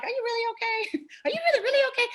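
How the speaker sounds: squeaky high, laughing disbelief; incredulous laughing disbelief. Retold or imitated. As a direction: laugh while asking in disbelief. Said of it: "I'm like".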